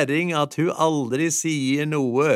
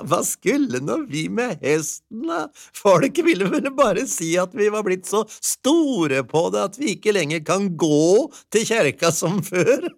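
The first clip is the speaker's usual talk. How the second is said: higher wife voice, laughing at the end; amused old wife; wife dismissing the horse; chuckling wife; 7 semitones higher, 3 dB louder than usual. A narrator's reading. Wife's happy voice.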